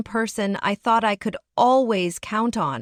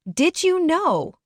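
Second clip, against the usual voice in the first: slow, higher "Did you know?"; trembling demand; 7 semitones higher, slower than usual. Acted. Husband confronting his wife, slow shaken demand. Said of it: "he demanded".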